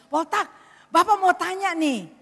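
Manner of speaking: high, loud summons; calling out. Acted. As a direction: call a child over. Pastor calls the child over.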